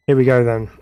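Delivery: ordinary talk, nothing marked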